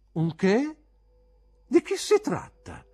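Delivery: very high, loud questioning; mocking pupil's shrill-pitched challenge; scornful incredulity. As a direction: query the word as if absurd. Pupil mocks teacher's word gentleman.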